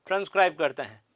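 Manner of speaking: ordinary talk, nothing marked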